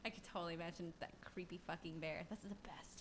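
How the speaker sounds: hushed creepy whisper. Real, not acted. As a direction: breathe it out in a whisper.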